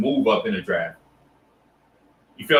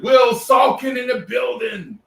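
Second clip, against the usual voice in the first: hype shout-out; 7 semitones higher than usual. Real, not acted. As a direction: announce him like he just walked in the arena. Streamer hyping a viewer's arrival.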